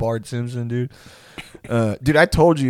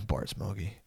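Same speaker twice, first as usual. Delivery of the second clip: very soft, low catchphrase; wasted mumble; 5 semitones lower, 14 dB quieter than usual. Retold or imitated. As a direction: barely get it out, zonked. Wasted Jay mumbles his catchphrase.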